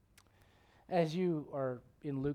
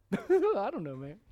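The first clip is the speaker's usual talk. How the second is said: high, loud goofy drawl; comic, high-pitched country impression; Brother Dobbins; 6 semitones higher, 9 dB louder, slower than usual. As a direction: mimic a goofy Arkansas missionary. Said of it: "that's how he talks".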